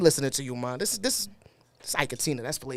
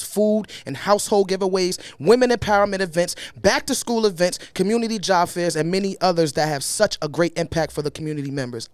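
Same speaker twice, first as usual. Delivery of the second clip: usual speaking voice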